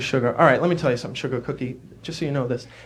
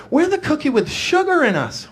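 sugar cookie voice; 11 semitones higher than usual. Acted. Sugar cookie voiced, much higher.

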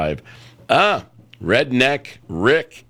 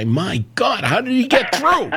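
much higher, amazed disbelief; astonished exclamation; 14 semitones higher than usual. Retold or imitated. Host retelling hearing a persistent caller on air, astonished and high. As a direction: act out gasping at the radio in wonder. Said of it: "I'll say".

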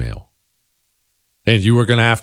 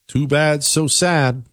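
higher-pitched sing-song taunt; mock pity; 7 semitones higher, slower than usual. Host taunting listeners uneasy with the call to prayer, mock pity. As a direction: brush them off with fake sympathy.

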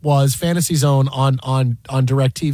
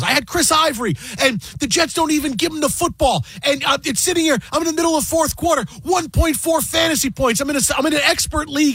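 high, fast, whiny complaint; mimicking a frantic Rappaport, pitched way up; imitating Rappaport; 9 semitones higher than usual. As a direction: rant like a sore fantasy loser. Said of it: "And he goes".

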